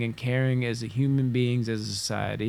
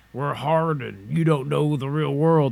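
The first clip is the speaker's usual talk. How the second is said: exaggerated tough guy; 2 semitones higher, 4 dB louder than usual. Caricaturing the hardened side with a mild tough-guy voice. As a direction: lean into a put-on tough-guy tone. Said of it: "I'm over-exaggerating, like, that's not my voice".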